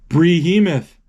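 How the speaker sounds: gasp, then belt out the name; dramatic gasp. Acted. Kid retelling a movie gasps at the prize. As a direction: gasp then announce it loud. Announcing the film's big prize.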